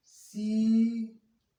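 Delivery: melodious teaching demo; long-vowel reading. Shown as a demonstration. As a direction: hold शी long and level, then त, ल. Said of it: "बड़ी की मात्रा को हम कभी भी खींच कर नहीं पढ़ते".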